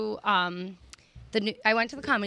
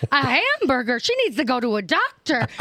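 high, louder mock disbelief; imitated appalled comedian; 4 semitones higher, 4 dB louder than usual. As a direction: mimic her outrage to the whole room. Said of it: "And she goes".

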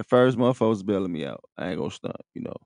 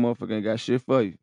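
usual speaking voice

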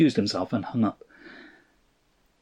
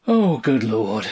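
slow, drawn-out groan falling away; exasperated groan; 3 dB louder, slower than usual. A narrator's reading. Husband despairs at wife's answer, groaning. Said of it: "he groaned".

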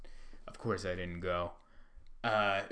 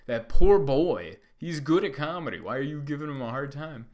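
loud, higher voice of a shocked crowd; appalled audience member; 5 semitones higher, 8 dB louder than usual. Retold or imitated. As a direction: scold the wrestlers on the poor victim's behalf. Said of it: "They were like".

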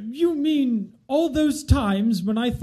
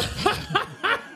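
breathy laugh; 10 semitones higher, 4 dB quieter than usual. Acted. Laughing at the smell.

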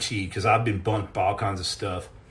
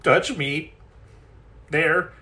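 higher, put-on timid plea; mock pleading; 4 semitones higher, slower than usual. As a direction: beg like a nervous diver, hammed-up. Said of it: "we're sitting over there going".

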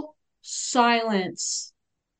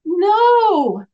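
emphatic no; 8 semitones higher, 6 dB louder than usual. Retold or imitated. Client's surprising answer.

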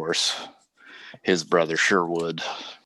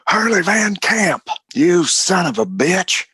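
higher, louder angry shout; furious outlaw yell; 12 semitones higher, 6 dB louder than usual. Acted. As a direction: bellow his name across the hallway. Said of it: "another voice that says".

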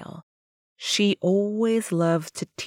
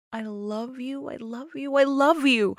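hushed, higher-pitched, repeated declaration building in volume; mad loving words; 6 semitones higher, 5 dB quieter than usual. Acted. Parting lover asked to say it again, fervently loving. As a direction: start very soft and let the love pour out. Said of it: "mad loving words".

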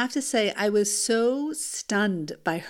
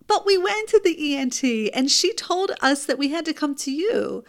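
much higher, exasperated protest to the pediatrician; exasperated disbelief; 6 semitones higher, 3 dB louder than usual. Retold or imitated. Mother tells pediatrician about the ENT, exasperated and much higher. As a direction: pitch it up in baffled disbelief. Palm to forehead.

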